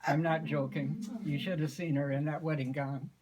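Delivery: ordinary delivery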